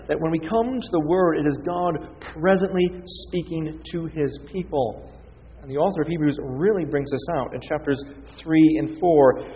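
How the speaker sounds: ordinary talk, nothing marked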